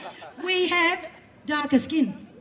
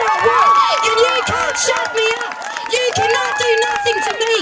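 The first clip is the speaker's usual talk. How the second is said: raised, louder voice, defiant at the police; loud defiance; 2 semitones higher, 6 dB louder than usual. Real, not acted. Protester defying the watching police officers, loud and defiant. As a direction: stand your ground and project it louder.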